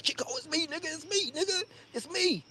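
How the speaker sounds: high, hushed acted-out plea; mock-panicked high whisper-shout; urgent pleading. Hiding, urgently identifies himself to friend.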